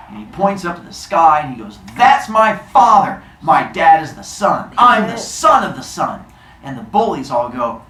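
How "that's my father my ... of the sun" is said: loud, high declaration; proud boast. Acted. Son boasting to bullies about his dad, loud and proud. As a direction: proclaim it loudly with boyish pride.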